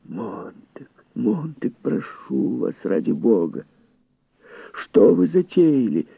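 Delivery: hushed start rising to high urgency; anxious, imploring appeal; pleading. A narrator's reading. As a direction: beg him to stop, voice climbing. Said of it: "умолял Фабер".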